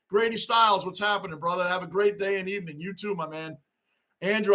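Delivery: ordinary talk, nothing marked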